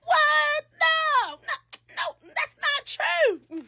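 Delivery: high, slow protesting voice; shrill-free high whine of denial; high panicked denial. Retold or imitated. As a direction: squeal it out, caught in a lie. Denying on the phone, panicked.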